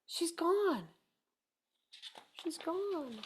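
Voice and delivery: soft, falling and dropping to almost nothing; hushed. Acted. Reader tells children the bird is gone, hushed. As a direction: let it fade away in dismay.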